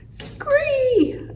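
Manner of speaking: long, very high screech; frog screech. A narrator's reading. Frog rescuing hamster from the bag, screeching. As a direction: shriek it in piercing falsetto. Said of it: "Og repeated".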